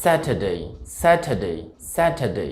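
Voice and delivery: স্যাটারডে as "SAT-uh-day", no r; correct British pronunciation. Shown as a demonstration. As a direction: model স্যাটারডে, crisp t, silent r. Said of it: "সঠিক উচ্চারণটা আসলে হবে".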